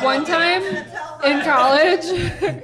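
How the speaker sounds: loud, slow laugh through the words. Real, not acted. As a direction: laugh while you start the story. Starting an embarrassing story.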